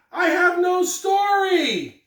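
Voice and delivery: high, slow, wailing complaint; childish despair. Acted. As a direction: whine it out like a stuck little kid. Ralph despairs over blank page.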